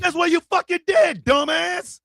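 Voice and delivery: loud high furious yell; high loud outraged rant; angry shout. Real, not acted. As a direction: shout the insult down at him. Ranting at a movie father.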